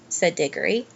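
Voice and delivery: plain reading voice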